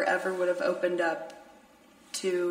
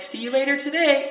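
chipper goodbye; 6 semitones higher, 6 dB louder than usual. Retold or imitated. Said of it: "you were like".

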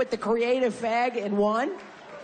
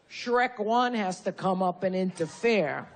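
ordinary talk, nothing marked

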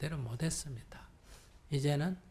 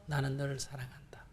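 hushed, loving whisper; tender whisper; 4 semitones lower, 9 dB quieter than usual. Pretend. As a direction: whisper it softly to the child. Mother's loving words to stammering child. (속삭이듯 다정하게)

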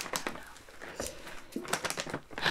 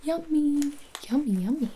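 voiced, higher sing-song yummy; cooing sing-song; 7 semitones higher than usual. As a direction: coo it to the baby. Cooing to baby.

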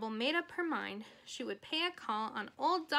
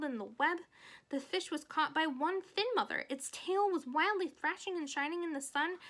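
put-on excited child spinning a tale, higher pitch; animated, higher-pitched girl building up the drama; Fern, eager storyteller; 5 semitones higher than usual. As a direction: tumble on with breathless enthusiasm like a little girl. Voices Fern telling her tale.

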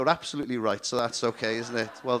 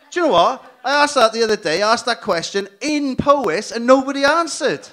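higher, louder punchline delivery; loud riff; 9 semitones higher, 11 dB louder than usual. Real, not acted. Comic telling the crowd about Powys, louder. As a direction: punch the joke out.

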